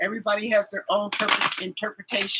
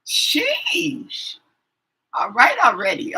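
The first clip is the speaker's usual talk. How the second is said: exasperated; 7 semitones higher, 5 dB louder, slower than usual. Retold or imitated. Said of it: "and I'm just like".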